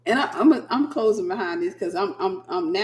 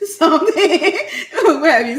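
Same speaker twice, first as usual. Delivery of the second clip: loud, laughing out the words; cracking up at the memory; 8 semitones higher, 8 dB louder than usual. Real, not acted.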